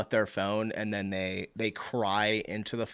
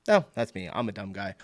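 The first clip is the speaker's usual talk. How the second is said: ordinary talk, nothing marked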